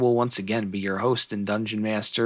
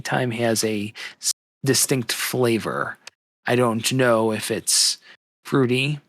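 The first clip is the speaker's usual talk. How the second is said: ordinary talk, nothing marked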